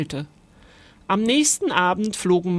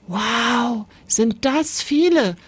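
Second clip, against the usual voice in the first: high, drawn-out wonder; amazed; 4 semitones higher, slower than usual. A narrator's reading. Ghost boy seeing masses of ants, high drawn-out amazement. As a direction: marvel at it, stretching the words. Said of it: "sagte Timon staunend".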